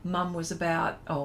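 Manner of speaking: usual speaking voice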